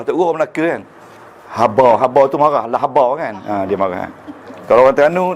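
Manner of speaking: ordinary delivery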